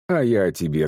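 ordinary delivery

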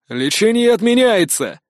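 booming drill instructor. A narrator's reading. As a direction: thunder the order out. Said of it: "громогласно произнёс злой инженер".

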